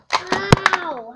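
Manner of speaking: loud high ow; pain yelp. Acted. Hurt character yelps loudly.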